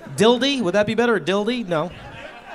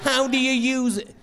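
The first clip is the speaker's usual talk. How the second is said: awkward laughing; 7 semitones higher, 3 dB quieter, slower than usual. Retold or imitated. Awkwardly asking the clerk.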